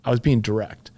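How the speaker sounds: ordinary talk, nothing marked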